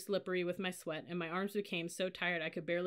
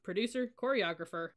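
plain reading voice

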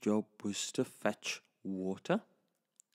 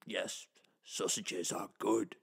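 lower, lazy dog voice; a dog savoring his meal, a bit lower; smug dog; 4 semitones lower, 3 dB quieter than usual. Acted. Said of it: "said the dog".

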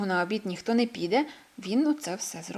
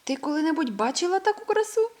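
awed question; 8 semitones higher than usual. A narrator's reading. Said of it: "спитав сповз Мартіни".

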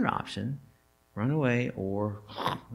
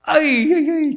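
high loud dismayed ay ay ay; exasperated ay ay ay; 12 dB louder than usual. Real, not acted. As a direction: wail ay ay ay in mock dismay. Reacting to bold words.

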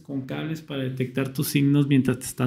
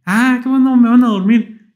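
much higher and louder, happy relief; light up at the news they'll put you under; relieved delight; 8 semitones higher, 6 dB louder than usual. Retold or imitated. Patient relieved seeing the anesthesia mask, much higher and louder.

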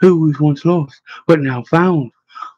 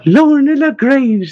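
high, drawn-out delighted exclamation; ecstatic greeting; 10 semitones higher, 3 dB louder, slower than usual. A narrator's reading. Elder sister greeting the younger Lorna, ecstatic. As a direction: greet them with slow, rising-voiced delight. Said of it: "Sister Bryce was ecstatic".

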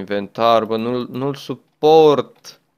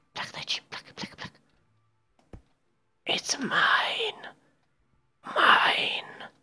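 soft whispered greedy voice; possessive whisper; 13 semitones higher, 8 dB quieter, slower than usual. Pretend. Guarding the newborn dinosaur from the friend, possessive whisper. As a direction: hiss it like a hoarding creature.

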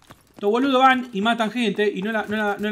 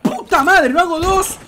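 high, loud exasperated yell; frustrated outburst; 10 semitones higher, 8 dB louder, much slower than usual. Real, not acted. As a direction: blurt it out in frustration.

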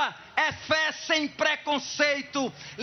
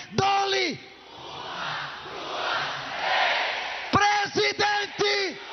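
auctioneer; 5 semitones higher, slower than usual. Acted. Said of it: "final auction count climax".

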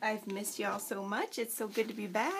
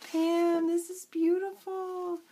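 delighted; 6 semitones higher, 3 dB louder, slower than usual. Real, not acted. Thrilled by gifted paper.

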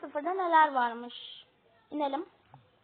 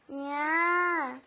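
ordinary talk

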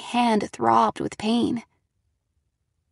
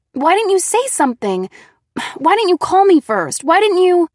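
high, loud, mounting reproach; upset scolding; 8 semitones higher, 8 dB louder than usual. Acted. Girl berates Nate for surprising her, upset and loud. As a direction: fire accusing questions, louder and higher.

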